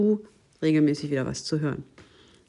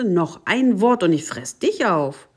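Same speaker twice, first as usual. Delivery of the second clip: louder storybook threat; threatening call; 3 semitones higher, 7 dB louder than usual. A narrator's reading. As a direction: raise the voice in a menacing warning. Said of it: "rief die Katze". (laut drohend vorgelesen)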